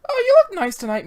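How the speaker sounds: put-on high, chirpy young voice; girlish character. Youngster complimenting the lady boss, high and sweet.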